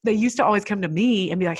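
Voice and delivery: normal talking voice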